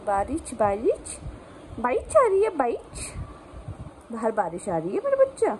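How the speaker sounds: playful pet-parent coo. Real, not acted. Explaining rain to dog.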